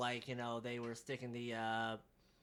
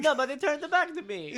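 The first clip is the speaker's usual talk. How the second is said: mock Russian accent; 9 dB louder than usual. Both riff in mock accents.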